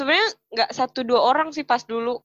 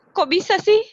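high, louder puzzled question; baffled disbelief; 9 semitones higher, 5 dB louder than usual. Retold or imitated. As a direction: ask it as if thrown by the remark. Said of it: "bingung, terus cuma ketawa".

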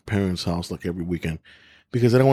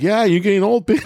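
higher, sassy retort; cheeky comeback; 10 semitones higher, 3 dB louder than usual. Retold or imitated. Answering relatives, a cheeky mock comeback. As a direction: fire back with a smirk. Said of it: "I was like".

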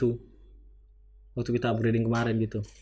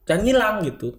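higher-pitched, reproachful jab; retold pointed dig; 9 semitones higher, 4 dB louder than usual. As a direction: imitate telling off a senior student. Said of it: "saya nyindir jatuhnya biasanya". (sindiran yang ditirukan)

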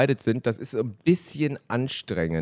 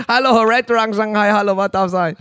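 high, loud mock takeaway greeting; restaurant phone answer; 10 semitones higher, 8 dB louder than usual. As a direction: play a chirpy order-taker on the phone.

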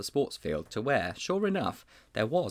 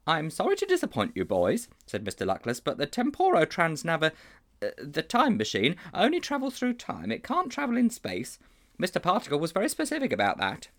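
a touch higher, earnest schoolmaster; lightly raised voice of a worried schoolmaster; lightly voiced teacher; 7 semitones higher than usual. A narrator's reading. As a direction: confide like a troubled old teacher. Teacher disappoints the boys.

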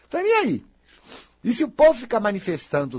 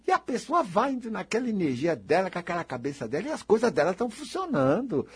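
ordinary delivery

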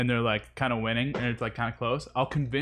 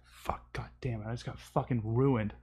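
muttered frustration; 8 dB quieter than usual. Retold or imitated. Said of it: "malding there".

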